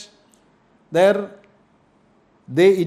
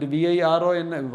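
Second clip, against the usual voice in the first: ordinary delivery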